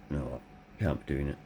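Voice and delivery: hushed, defeated wife's voice; resigned quiet. Retold or imitated. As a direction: let it fall away as she gives up. Voices wife giving up.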